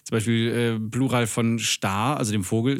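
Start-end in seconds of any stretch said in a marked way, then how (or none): none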